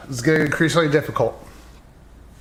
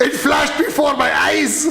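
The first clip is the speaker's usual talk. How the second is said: laughing; 8 semitones higher than usual. Real, not acted. Laughing after the mock shooting.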